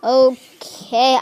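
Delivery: normal talking voice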